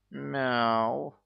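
high, downcast cat cry; dejected meow. A narrator's reading. Cat answering mother squirrel about midnight, dejected meow. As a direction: meow in resignation. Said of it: "удручённо ответил Геночка".

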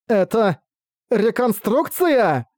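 high, very slow horrified question; horrified disbelief. Acted. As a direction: drag it out in disgust. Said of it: "исполненный отвращения и отчаяния".

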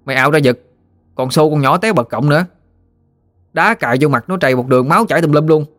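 acted tattling child standing up boldly; defiant boy. A narrator's reading. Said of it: "Nó nghinh nghinh mặt lên".

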